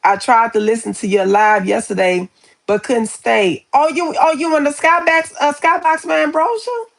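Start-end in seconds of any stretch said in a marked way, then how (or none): 3.7-6.8 high, bright surprised call; light up at seeing a friend; delighted surprise. Real, not acted. Spotting her viewer Brosia in the chat, delighted surprise.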